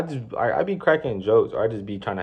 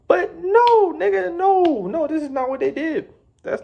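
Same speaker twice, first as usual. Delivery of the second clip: very high, exclaimed denial; outraged. Real, not acted. [name] venting about the managers, outraged high. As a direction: burst out in disbelief.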